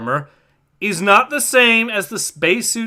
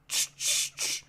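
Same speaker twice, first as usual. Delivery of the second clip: mouth static clicks; 11 dB quieter than usual. Acted. Radio static imitated.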